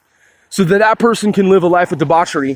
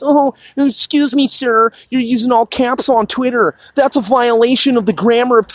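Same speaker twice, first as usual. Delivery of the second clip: higher-pitched, officious mock complainer; mock caps police; 5 semitones higher than usual. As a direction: imitate a fussy rule enforcer. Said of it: "You're the all caps police".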